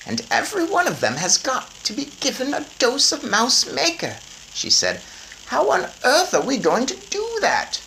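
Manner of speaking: high, brisk grandmother voice. A narrator's reading. Grandmother planning with her grandson, high brisk voice. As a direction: plan it out like a determined granny.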